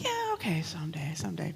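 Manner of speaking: a reluctant, hushed yes; hushed half-whisper. Retold or imitated. A young wife half-whispering her reluctant yes to her husband. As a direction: whisper the someday as if giving in.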